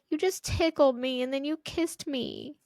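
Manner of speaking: put-on a touch of a puzzled little girl; little daughter. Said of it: "And she's like".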